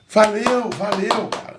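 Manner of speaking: high, breezy fake encouragement; hollow praise. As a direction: toss out empty praise. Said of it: "Não valeu".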